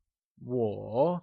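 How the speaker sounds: 我 slowly falling, then rising; teacher's slow model of 我, dipping then lifting; third tone. Shown as a demonstration. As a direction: stretch 我 through a low dip and back up. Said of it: "also known as the third tone".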